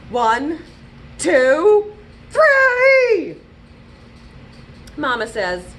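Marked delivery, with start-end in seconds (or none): 0.1-3.4 from firm to a high, loud shout; building count. Acted. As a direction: count up until it bursts out. Sullivan counting, getting louder.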